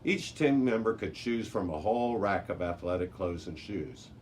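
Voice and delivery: ordinary delivery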